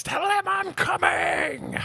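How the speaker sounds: long, high shouted movie threat; imitated scream. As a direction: scream it slowly, stretching every word. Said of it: "And screams".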